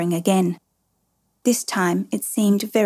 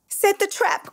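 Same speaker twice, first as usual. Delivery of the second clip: crying out; 12 semitones higher than usual. A narrator's reading. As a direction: cry the order out at the top of your pitch. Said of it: "cried Maman".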